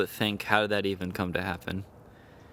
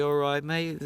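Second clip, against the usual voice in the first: higher-pitched put-on British greeting; mock British accent; 5 semitones higher, slower than usual. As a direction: play a British settler in a hammy accent. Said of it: "especially the British people, they came here".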